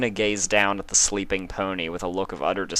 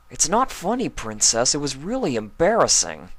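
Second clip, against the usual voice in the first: higher, louder flustered protest; embarrassed Spike; 9 semitones higher, 4 dB louder than usual. Acted. Spike protesting to Luna, embarrassed and flustered. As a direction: whine that it isn't funny.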